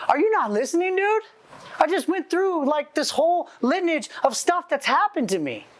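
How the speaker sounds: high-pitched, incredulous complaint; acted-out frustration, voice pitched well up; retold exasperated protest. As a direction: imitate a man pleading to be heard. Retold upset man protests to pastor.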